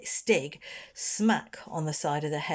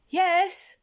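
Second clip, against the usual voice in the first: put-on timid boy; 14 semitones higher, 5 dB louder than usual. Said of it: "said the other".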